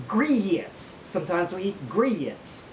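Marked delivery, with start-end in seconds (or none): 0.1-0.8 "Grits" stretched into two syllables; drawn-out syllable. Shown as a demonstration. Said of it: "one-syllable words that we draw out". 1.9-2.7 "grits" stretched slowly into two syllables; drawn-out syllable. Shown as a demonstration. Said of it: "one-syllable words that we draw out".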